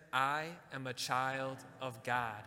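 slow, deliberate declaration leading the congregation; slow affirmation. Shown as a demonstration. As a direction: say it slowly, word by word, as a vow. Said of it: "Can we say that together?".

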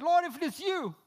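high-pitched retold call; mild yell, high and urgent. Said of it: "He yelled out".

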